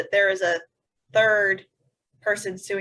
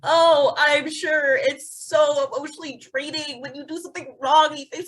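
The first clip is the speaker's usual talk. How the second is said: higher, sarcastic sneer at a rich man's self-pity; sarcastic mockery; 5 semitones higher than usual. Co-host mocks the owner's statement, sarcastic and higher. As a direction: drip with sarcasm over his "emotional" week.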